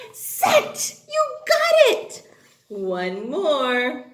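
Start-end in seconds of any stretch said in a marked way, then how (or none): 0.1-1.0 "sit" in one very high burst; triumphant blending. Shown as a demonstration. Sounds out word, then blends. 1.1-2.3 high, loud cheer; excited praise. Real, not acted. Praising the children.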